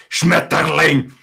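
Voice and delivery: loud angry German bark; exaggerated harsh German anger; really angry. Shown as a demonstration. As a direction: shout it like a furious command. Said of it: "things also really angry".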